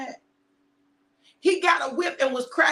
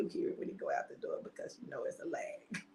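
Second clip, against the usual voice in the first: whispered aside; 4 semitones higher, 17 dB quieter, faster than usual. Real, not acted.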